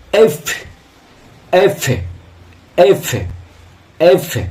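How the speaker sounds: এফ as crisp "eff" with a long airy f; correct pronunciation. Shown as a demonstration. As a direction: release the f of এফ as friction. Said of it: "উচ্চারণটা হচ্ছে". (এফ-এর সঠিক উচ্চারণ, হাওয়া ছেড়ে f)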